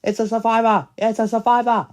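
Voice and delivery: high, loud, repeated cry; excited shout. Acted. Announcing the survivor to the group, excited shout. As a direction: shout the news twice, thrilled.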